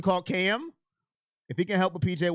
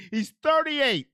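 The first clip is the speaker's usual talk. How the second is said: incredulous correction; 9 semitones higher, 5 dB louder, slower than usual. Real, not acted. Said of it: "correcting a chatter, incredulous".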